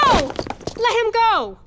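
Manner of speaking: loud, high cry to stop him; pleading shout. Acted. Sister begging the hero to let the villain go, loud. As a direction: hold him back with your voice.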